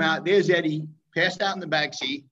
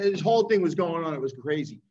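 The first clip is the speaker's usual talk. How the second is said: ordinary talk, nothing marked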